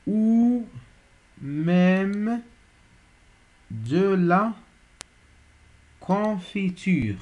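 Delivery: slow, spaced-out dictation with long pauses; measured classroom dictation, punctuation spoken aloud; dictation reading. Shown as a demonstration. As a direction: read each phrase slowly so pupils can write it. Teacher reads a dictation aloud.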